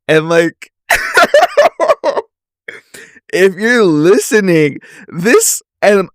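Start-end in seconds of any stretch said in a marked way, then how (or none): 0.9-2.2 high-pitched burst of laughter; real squealing laugh; cracking up. Real, not acted. Cracks up reading the messages. 3.3-6.1 talking high, just out of a laughing fit; amused, pitch riding up after giggles; tickled. Real, not acted. Still amused after cracking up.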